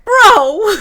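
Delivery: loud, high "Bro!"; excited shout. Real, not acted. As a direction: blurt it out in disbelief. Outburst when the movie comes up.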